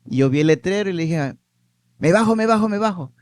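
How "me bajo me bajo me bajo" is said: higher, eager repeated call to get off; blurting it out in a rush of eagerness; urgent excitement. Retold or imitated. Young man telling grandmother he's getting off the bus, excited.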